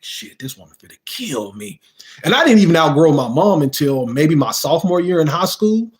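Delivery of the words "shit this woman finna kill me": whispered, fearful aside to himself; scared whisper. Retold or imitated. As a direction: mutter it in a whisper, dreading the whooping. Sniffling while walking home to punishment.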